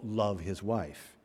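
"love" slowed and set apart, softly; quote marks on LOVE. Real, not acted. Preacher on an unfaithful husband, slow quoted "love". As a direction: say "love" slowly, as a doubted word in quotes.

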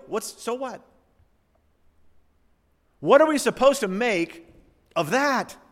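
ordinary delivery

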